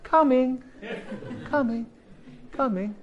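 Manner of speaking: high reply that fades to soft; distant reply. Retold or imitated. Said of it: "the voice back".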